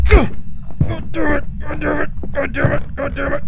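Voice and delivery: high, drawn-out exasperated yell; frustrated yelling. Real, not acted. Player killed by the ghost, high frustrated yelling. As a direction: wail it out as you die.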